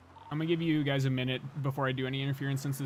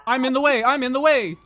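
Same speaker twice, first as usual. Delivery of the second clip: shouting; 7 semitones higher, 9 dB louder than usual. Real, not acted. Yelling while blocking the road.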